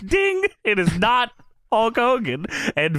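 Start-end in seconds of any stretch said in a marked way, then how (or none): 0.0-0.4 high ding sound effect; mock ding. Host mimicking the lie detector, high ding. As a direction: chime it like a bell.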